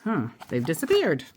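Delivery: ordinary delivery, nothing marked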